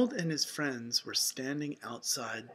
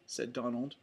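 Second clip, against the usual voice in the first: usual speaking voice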